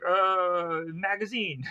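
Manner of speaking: drawn-out "uh", then blurted excuse; acted floundering for an alibi; caught-out stalling. Lobo improvises an excuse when caught.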